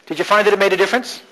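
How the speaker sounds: normal talking voice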